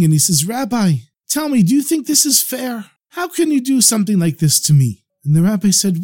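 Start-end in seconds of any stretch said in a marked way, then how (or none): 0.5-5.0 high, pleading complaint without sobs; a touch of crying, voiced high; tearful reproach. A narrator's reading. Farmer confronting the rabbi, mildly tearful. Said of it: "and he's crying".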